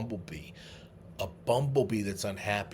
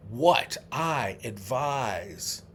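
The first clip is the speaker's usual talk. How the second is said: slow recitation; much slower than usual. Shown as a demonstration. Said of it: "slowly spelling out the quote".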